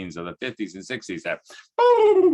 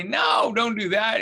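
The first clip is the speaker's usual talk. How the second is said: retold shout; 12 semitones higher, slower than usual. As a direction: call out to stop a kid mid-cast. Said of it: "And he'd say".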